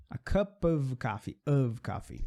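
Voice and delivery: of in a cup of said with a clear v; model pronunciation. Shown as a demonstration. Teacher modeling a cup of coffee for students. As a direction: slowly sound the v in of. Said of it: "Essa é a pronúncia adequada".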